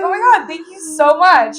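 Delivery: high, loud mock-flattered gush; playfully overdone thanks; retold gushing. Retelling her gushing reply.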